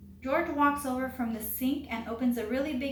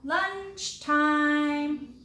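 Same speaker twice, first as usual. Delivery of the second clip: loud, higher, sustained call; yelling out; 4 semitones higher, 6 dB louder than usual. A narrator's reading. Uncle Willie calls the waiting guests to eat, yelling out. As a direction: holler it out, holding the words long. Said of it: "Uncle Willie yells out".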